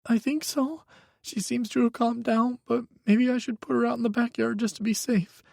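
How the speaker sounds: put-on high, tense young voice; a frightened young man pleading on the phone; young man, scared. A narrator's reading. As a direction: Micah telling Hawk his ex is dangerous, high and scared. Micah's voice.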